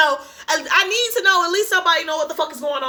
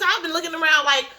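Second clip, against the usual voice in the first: normal talking voice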